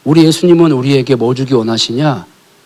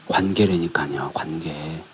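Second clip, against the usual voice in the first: whisper; 7 semitones lower, 10 dB quieter, slower than usual. Acted. Preacher drops to insistent whisper.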